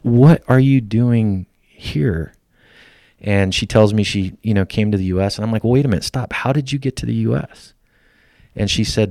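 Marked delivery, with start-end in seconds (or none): 0.0-2.3 higher, louder and slow; astonished. Retold or imitated. Veteran asking Iraqi teller, astonished and slow. 5.6-7.7 excited. Retold or imitated. Excited questioning of teller.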